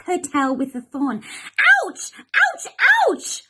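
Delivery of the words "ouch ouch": plain reading voice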